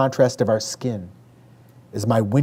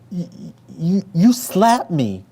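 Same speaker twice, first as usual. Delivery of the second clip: high, slow, stammering through tears; tearful insisting; 7 semitones higher than usual. A narrator's reading. Younger daughter accusing her sister, stammering and tearful. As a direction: stutter it out, wounded and insistent. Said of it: "Rachel insisted".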